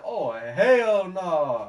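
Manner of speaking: loud, slow, drawn-out hell no; mock panic at the shotgun, loud and slow; alarmed exclamation. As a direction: stretch it out in alarmed disbelief. Seeing dad with shotgun.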